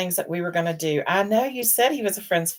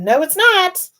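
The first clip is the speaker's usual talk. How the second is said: higher, louder mock protest; refusing the husband's dog in a raised voice; retold refusal; 14 semitones higher, 9 dB louder, slower than usual. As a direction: push back loudly and high. Acted quarrel with husband.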